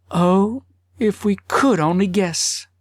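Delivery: longing for what can't be known. A narrator's reading. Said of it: "responded longingly".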